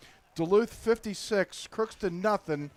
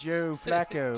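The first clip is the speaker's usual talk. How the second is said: bad Baltimore accent; slower than usual. Shown as a demonstration. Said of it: "my bad Baltimore accent".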